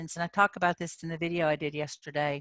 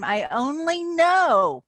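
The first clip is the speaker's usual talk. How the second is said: acted frustration; 10 semitones higher, 6 dB louder, slower than usual. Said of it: "I'm acting".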